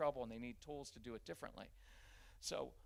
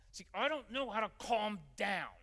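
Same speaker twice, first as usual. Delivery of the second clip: put-on higher, louder child's voice; upset child; 7 semitones higher, 7 dB louder than usual. A child who can't calm down, voiced higher and louder. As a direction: speak as the overwhelmed kid. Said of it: "voicing the upset child".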